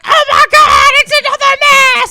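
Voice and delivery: high shocked cry of "another mask"; mock shocked shout. Friend retelling the crowd's shock at the mask reveal, high shout. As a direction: yell it out like the whole crowd gasping. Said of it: "We're like".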